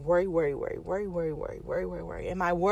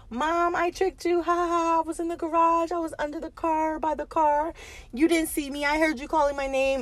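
much higher, childlike taunting voice with a mock laugh; teasing little daughter; 10 semitones higher than usual. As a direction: play the giggling kid who tricked mom. Mother voicing daughter's teasing taunt.

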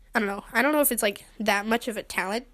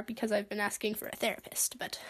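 whispered aside; 9 dB quieter than usual. Real, not acted. Embarrassed aside about therapy.

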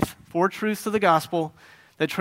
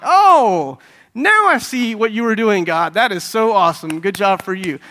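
mock sudden realization; 5 semitones higher, 9 dB louder than usual. Believer congratulating God once the trial is over, loud mock delight.

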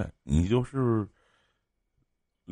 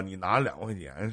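ordinary talk

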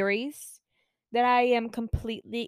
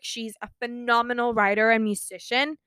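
ordinary talk

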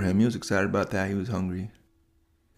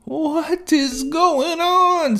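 put-on high, slow, drawn-out boy's voice; boy Tanner amazed; 4 dB louder, much slower than usual. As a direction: stretch it out, high and wondering. Tanner wakes floating in space.

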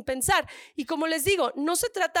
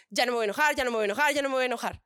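low, rapid-fire repetition to oneself; acted self-pep-talk, pitched down; muttered self-mantra; 4 semitones lower, much faster than usual. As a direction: chant it under control, like a resolution. Acts out repeated self-promise.

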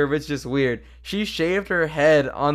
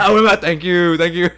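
high loud joking shout; loud mock yell; 5 semitones higher, 8 dB louder than usual. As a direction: blurt it like a punk ending. Mock punk song ending.

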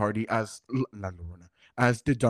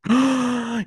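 surprised gasp; 13 semitones higher, 3 dB louder than usual. Real, not acted. Hears friend went flying.

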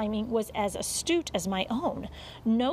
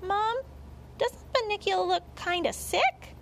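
put-on high-pitched little boy; child's voice; 10 semitones higher than usual. A narrator's reading. Boy close to the rabbit asking mother, childlike. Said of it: "Toby put his face close to the rabbit".

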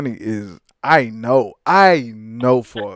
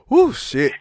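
mock winded gasp; 8 semitones higher than usual. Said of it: "she gave her testimony and said".

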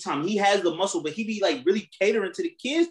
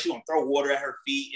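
ordinary talk

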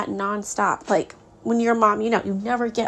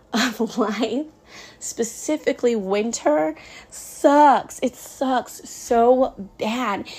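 exasperated; much slower than usual. Real, not acted. Venting about winter season.

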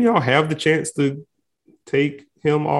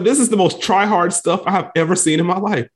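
mocking disbelief; 6 semitones higher than usual. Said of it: "I'm like".

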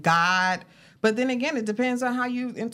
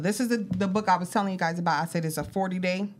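ordinary delivery

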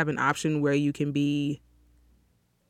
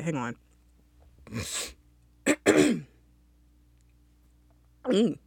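ordinary delivery

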